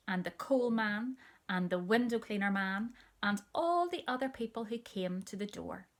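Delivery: ordinary delivery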